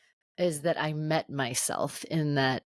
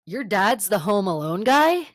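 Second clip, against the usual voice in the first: louder, slow, incredulous retold question; blurted disbelief; 4 semitones higher, 6 dB louder than usual. Student finding out who a classmate's dad is, blurted disbelief. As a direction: blurt it out, stunned and starstruck. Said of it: "just like a gut th-, reaction".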